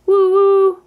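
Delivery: high, loud playful train whistle. Pretend. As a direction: hoot like a toy train whistle. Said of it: "The kittens pretended to be a train".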